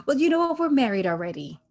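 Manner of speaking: imitating a sudden announcement; retold blurted confession. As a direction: blurt it out as a mock big reveal. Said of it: "Sumit just comes out".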